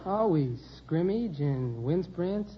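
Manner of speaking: ordinary delivery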